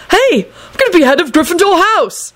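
high-pitched thrilled outburst; excited. A narrator's reading. Neville remembering he will be head of house, excited. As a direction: exclaim it with sudden delight. Sudden delighted realisation.

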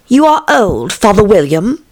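put-on high, brisker girlish recitation; poem recitation. A narrator's reading. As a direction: recite it as Alice starting her verse. Alice begins reciting the poem.

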